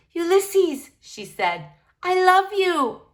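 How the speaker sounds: high, slow declaration of love; tender. Acted. Girl hugging her squirrel, tenderly. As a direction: pour love into it.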